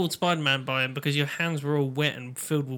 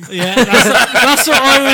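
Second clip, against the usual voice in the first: laughing; 7 semitones higher, 13 dB louder than usual. Real, not acted. Laughing at the wheeze.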